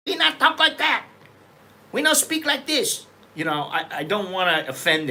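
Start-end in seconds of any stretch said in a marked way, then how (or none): 0.1-3.1 much higher, broken English in an exaggerated Thai accent; mock Thai accent. Podcaster mimicking Thai speakers' English, in a hammed-up Thai accent. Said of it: "I don't wanna offend Thai".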